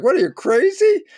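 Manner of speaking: high, loud incredulous outburst. Retold or imitated. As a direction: exclaim it as if they have lost their mind. Friend's shocked reaction, retold.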